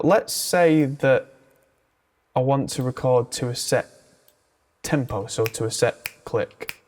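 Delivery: ordinary talk, nothing marked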